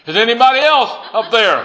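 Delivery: high, loud comic call upward; calling back. Acted. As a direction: shout up, hoping for another answer. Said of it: "and the man called back".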